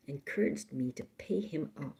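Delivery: ordinary delivery, nothing marked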